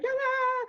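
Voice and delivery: loud, high-pitched mock scream; child's scream. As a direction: scream like a frightened child in a pool. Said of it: "she keeps screaming".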